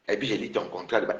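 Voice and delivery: low, very soft mock quote; hushed, belittling imitation; mimicked threat. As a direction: mimic the rival low and small.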